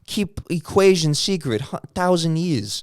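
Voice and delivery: hammed-up Chinese accent impression; mock Chinese accent. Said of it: "We're not bad at Chinese accents".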